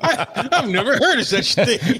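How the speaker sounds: high, laughing mockery; laughing mock echo. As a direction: echo the line while laughing. Repeating the line mockingly, laughing.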